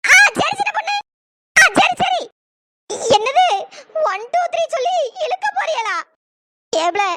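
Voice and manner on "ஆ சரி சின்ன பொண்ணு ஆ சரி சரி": eager shout. Acted. Eager agreement to the count.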